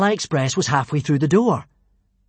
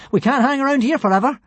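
urgent; 10 semitones higher, 4 dB louder than usual. Acted. Hiccup urges on.

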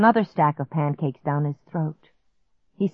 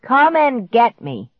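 loud, higher, slow dare; taunting call; 5 semitones higher, 5 dB louder, slower than usual. A narrator's reading. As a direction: drag out the challenge. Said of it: "he called out".

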